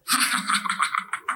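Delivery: breathy, voiceless cackle; devil's taunting laugh. Retold or imitated. Devil laughing at her, breathy taunting cackle.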